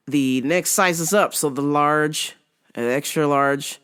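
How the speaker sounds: usual speaking voice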